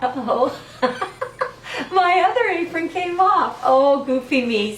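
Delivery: high, laughing giggle through the words; laughing at herself. Real, not acted. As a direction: giggle at your own clumsy mistake. Apron mishap, laughing at herself.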